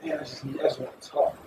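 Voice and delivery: soft, near-whispered plea; weary inner voice. Retold or imitated. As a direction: speak it like you just need to unload. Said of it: "and you feel like".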